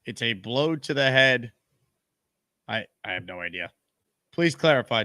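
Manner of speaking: ordinary delivery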